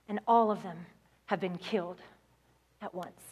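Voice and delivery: low, soft, halting delivery, acted; grave news-breaking. Police officer telling a father his children died, acted grave and hushed. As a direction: break it gently, with heavy pauses.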